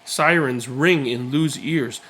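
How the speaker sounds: ordinary delivery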